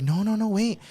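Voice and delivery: sudden high-pitched retold plea to wait; panicked no. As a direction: cut her off in a hurry, pitched high. Retold panic stopping her sister.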